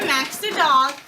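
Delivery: usual speaking voice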